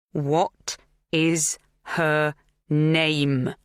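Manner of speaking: very slow, each word set apart; slowly. Acted. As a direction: drag every word out slowly. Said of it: "she said slowly".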